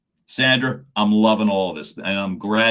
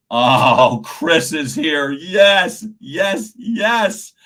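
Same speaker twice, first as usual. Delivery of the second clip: loud, high burst of real delight; excited greeting; 6 semitones higher, 7 dB louder, much slower than usual. Real, not acted. Coach spotting Chris joining the session, excited greeting. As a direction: cheer each yes as it climbs. Greeting an arriving crew member.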